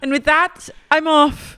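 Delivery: high, put-on British exit line; mock British. Co-host playing Meredith leaving, high mock British accent. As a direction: announce departure in mock British. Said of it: "goes back into a British accent".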